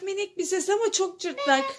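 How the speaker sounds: ordinary talk, nothing marked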